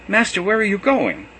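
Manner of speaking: a worried follower calling out; anxious disciple. A narrator's reading. Simon Rock asking Jesus where he goes, anxious and high.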